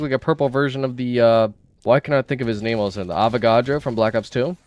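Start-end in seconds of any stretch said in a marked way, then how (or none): none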